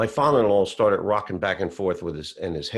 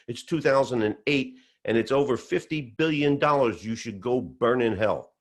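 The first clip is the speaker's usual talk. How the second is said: high, worked-up phone rant, louder than talk; mock scream, pitched up and insistent; retold screaming; 6 semitones higher, 3 dB louder than usual. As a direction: imitate a friend yelling down the phone.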